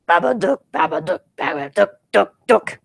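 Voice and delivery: staccato creature chant, rising at the end; Babadook. Teasing that the monster is coming, put-on creature chant. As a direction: chant the name in knocking bursts. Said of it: "how the Babadook sounds like".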